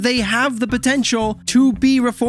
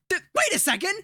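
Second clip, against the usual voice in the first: higher, much softer, far away; muffled from a closet; 12 semitones higher, 7 dB quieter, faster than usual. Acted. Trapped host finding the canister, muffled and high. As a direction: realize it from behind the door.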